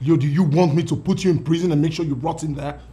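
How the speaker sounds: loud furious threat to his brother; angry threat. Acted. Older brother threatening Leo with prison, shouting.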